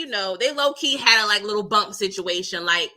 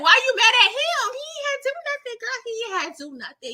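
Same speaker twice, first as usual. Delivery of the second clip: much higher and fast, mock outraged; high excited defence; 12 semitones higher, faster than usual. Defending Big Murder to Clifford, high and fast. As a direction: fire it off in a high squeal. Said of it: "I'm like".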